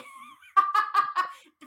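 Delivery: big bright burst of laughter; hearty laugh. Real, not acted. Host laughing at her church-shopping joke, hearty laugh. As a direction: laugh out loud at your own joke.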